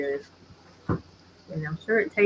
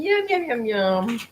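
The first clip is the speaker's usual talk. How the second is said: delighted yum; 6 semitones higher, slower than usual. Real, not acted. Delighted tasting.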